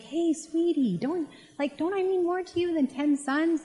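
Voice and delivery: lightly playing a husband pleading for his wife's love; sweet-talking husband. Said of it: "And he's kinda like".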